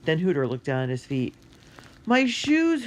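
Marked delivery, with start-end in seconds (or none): 2.0-2.8 high, loud cry of dismay; boy crying out over lost shoes. Acted. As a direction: cry out at a sudden loss. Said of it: "Hooter cried".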